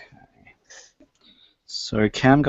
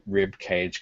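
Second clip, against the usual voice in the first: ordinary delivery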